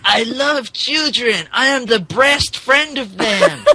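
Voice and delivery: high, put-on voice for the dog; squeaky mock voice. Reading the dog's lines in a high, put-on voice. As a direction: pitch it way up like a cartoon pup.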